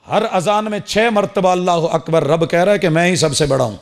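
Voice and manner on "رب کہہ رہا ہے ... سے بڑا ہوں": ordinary delivery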